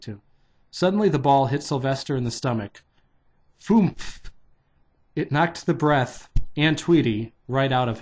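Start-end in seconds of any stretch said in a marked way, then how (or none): 3.6-4.3 high, puffed-out "foomf"; sudden cartoon-style oomph; punchy impact noise. A narrator's reading. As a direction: make the thump of a hit to the belly. Ball hits stomach, imitated impact.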